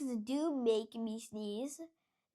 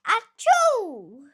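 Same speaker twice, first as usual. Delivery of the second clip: very loud high achoo; huge sneeze; 8 semitones higher, 15 dB louder than usual. Acted. Doctor Cat sneezes hard, very loud and high. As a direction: blast a giant sneeze.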